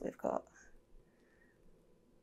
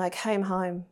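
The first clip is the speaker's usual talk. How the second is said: normal talking voice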